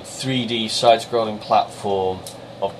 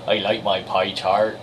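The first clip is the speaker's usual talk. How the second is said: slow West Country drawl with rolled R's; Somerset accent; 4 dB quieter than usual. Pretend. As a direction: roll the R's like a Somerset farmer. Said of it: "I'm from Somerset and I speak my overreactive R's".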